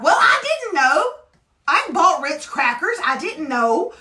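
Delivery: high, loud, whiny self-defence; defensive protest. Acted. Mom excusing forbidden food in the house, loudly defensive. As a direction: protest innocence with pitch jumping up. Defends her shopping to husband's accusation.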